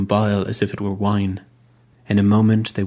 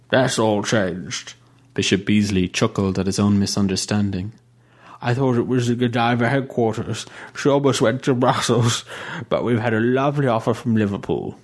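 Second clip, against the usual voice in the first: put-on fussy old bishop. A narrator's reading. Bishop voice again.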